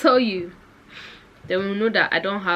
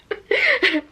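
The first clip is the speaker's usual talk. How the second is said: high breathless laugh. Real, not acted. Breathless laugh after a fall.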